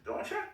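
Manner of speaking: "Don't you?" rising on "you"; questioning upward "Don't you?"; rising intonation. Shown as a demonstration. Teacher drilling the rising version of the tag.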